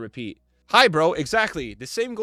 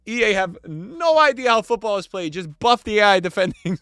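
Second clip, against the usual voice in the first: loud, raised read of an irate comment; mock exasperated rant; 5 semitones higher, 7 dB louder than usual. Streamer reads a complaint about defending, mock exasperated. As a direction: belt it out like a fed-up player.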